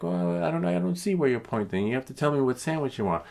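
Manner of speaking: lightly voiced stubborn clerk, high; high mocking clerk. The clerk refusing to understand, mocking and high. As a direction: mimic the unhelpful clerk. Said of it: "He's like".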